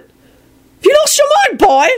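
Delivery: very high, slow, rude heckler voice; rude blurted heckle. A narrator's reading. Court member heckles Harry, high and rude. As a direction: sneer it at the young man. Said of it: "someone blurted out rudely".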